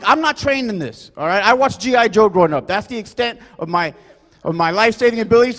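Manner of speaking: normal talking voice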